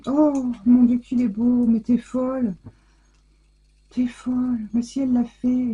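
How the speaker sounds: gushing over a lovely gift; delighted gasp. Real, not acted. Thrilled by a gift she unwraps.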